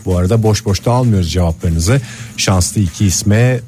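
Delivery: slow, measured station announcement; radio announcer. Acted. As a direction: read it like an on-air promo. Radio host announcement read. (radyo spikeri anons sesi)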